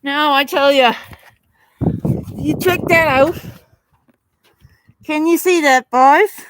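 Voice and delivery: loud, thrilled calling out; excited shout. Real, not acted. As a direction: shout it out with delight. Excited about greenhouse fit.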